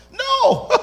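emphatic no. Real, not acted. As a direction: shoot the idea down. Preacher rejects wrong reading.

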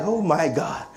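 low, softly retold despair; quiet dismay. Father finds children disobeying instructions, quiet retold dismay. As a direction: sink into an under-the-breath groan of words. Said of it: "And I'm like".

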